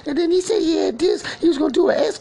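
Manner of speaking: high, tearful, sobbing words; crying while telling. Acted. Mourning the friend running down the street, crying. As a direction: push the story out through tears.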